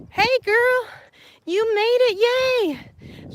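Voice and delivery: high, loud squeal of welcome; excited greeting. Real, not acted. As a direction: greet a friend who just showed up, thrilled. Greets friend joining the stream.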